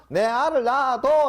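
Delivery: high, loud mock Gyeongsang blurt; Gyeongsang dialect. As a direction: shout it like a blunt Gyeongsang suitor. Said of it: "경상도에서 뭐라 그런다고요?".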